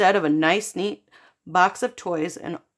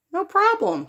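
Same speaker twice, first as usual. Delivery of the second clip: chirpy high agreement; 12 semitones higher than usual. Acted. Child cheerfully agrees.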